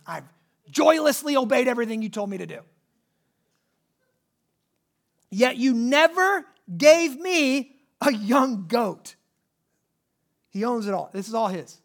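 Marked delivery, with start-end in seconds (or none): 0.1-9.2 put-on a touch of the sulking older brother; resentful older brother. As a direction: sulk it out like a bitter son. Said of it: "in my words".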